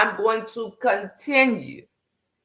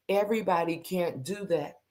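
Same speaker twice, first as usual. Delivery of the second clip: ordinary delivery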